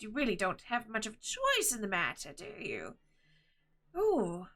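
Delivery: fussy little-man voice with gliding pitch; jolly gnome turned concerned. Acted. Gnomish husband warns Nim about the letter, worried voice. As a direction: advise anxiously in a swooping voice. Game master plays Arnold.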